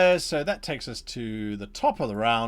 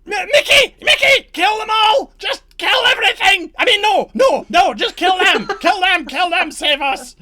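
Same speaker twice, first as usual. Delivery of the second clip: screeching high and loud, panicked orders; frantic shout; 13 dB louder than usual. Acted. Redcap calling Mickey to kill everything, frantic screaming. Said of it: "and he shouts".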